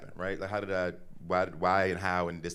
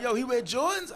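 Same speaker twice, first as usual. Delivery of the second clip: much higher, eager question; excited kids; 6 dB louder than usual. Retold or imitated. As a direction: fire it off like a thrilled schoolboy.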